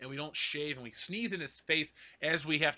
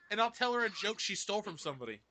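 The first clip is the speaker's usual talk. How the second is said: ordinary talk, nothing marked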